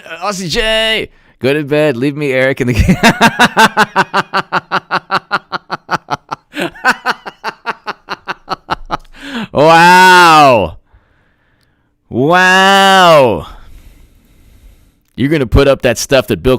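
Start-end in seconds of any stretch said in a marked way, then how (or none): 3.0-9.0 long real laugh. Real, not acted. 9.5-13.5 loud, drawn-out wow; mock shocked wow. Real, not acted. Reacting to chat comment.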